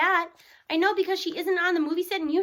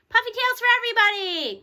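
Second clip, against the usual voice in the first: higher and louder triumphant cheer; cheering cry; 6 semitones higher, 5 dB louder than usual. Acted. Barnstorm cheers the class's good practice scores, shouting. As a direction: cheer it out, waving a crutch. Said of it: "cries Barnstorm".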